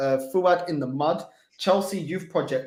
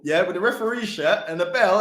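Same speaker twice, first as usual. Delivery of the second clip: normal talking voice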